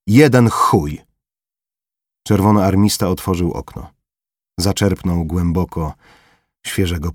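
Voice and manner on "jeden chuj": louder, higher scoff; dismissive retort. Acted. Corporal brushing off Formela, loud contemptuous scoff.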